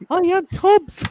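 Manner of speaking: a mock German accent. Showing off his German accent to the co-hosts, hammed up. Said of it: "My German accent was awesome".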